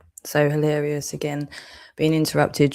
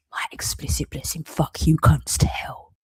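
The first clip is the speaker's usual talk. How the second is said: bitter whispered curse. Real, not acted.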